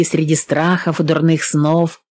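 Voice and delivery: usual speaking voice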